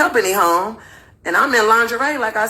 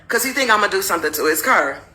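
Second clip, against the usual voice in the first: ordinary talk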